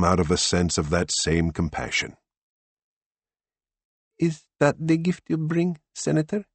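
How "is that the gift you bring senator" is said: put-on wistful accented woman. A narrator's reading. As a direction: ask gently, with longing. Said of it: "she asked in English".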